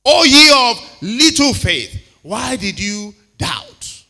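loud, slow rebuke of little faith; booming preacher's rebuke; preached rebuke. Acted. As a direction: thunder it out slowly. Jesus rebuking disciples, preached.

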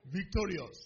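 ordinary talk

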